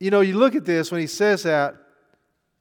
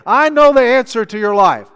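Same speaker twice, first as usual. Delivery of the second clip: much louder and higher, self-assured pitch; mock preacher boast; 7 semitones higher, 12 dB louder than usual. Preachers boast to the crowd they know the answer, mock-loud. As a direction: puff up like a preacher claiming it all. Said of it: "listen to any preacher".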